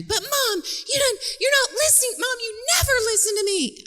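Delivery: put-on very high, rushed teen whine; hammed-up adolescent protest; whiny teenager. Daughter complaining her mother never listens, whiny.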